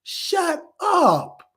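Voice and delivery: high, slow shout of shut up; annoyed shout. Retold or imitated. Vlogger yelling at negative reviewers, high exasperated shout. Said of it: "I'm like".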